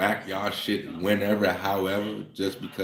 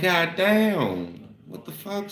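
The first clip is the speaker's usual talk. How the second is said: shocked outburst; 8 semitones higher, slower than usual. Retold or imitated. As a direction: pitch jumps higher in mock disbelief. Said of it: "So I'm like".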